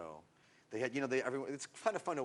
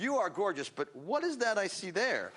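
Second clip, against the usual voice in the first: mock Lorenzo Lamas; 6 semitones higher, 4 dB louder than usual. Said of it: "Lorenzo Lamas would sit there ... and go".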